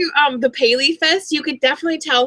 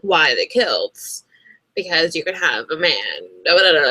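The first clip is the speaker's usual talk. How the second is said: imitating Diana; 9 semitones lower than usual. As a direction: drag the words out lazily in a low voice. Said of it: "That's the way she talks".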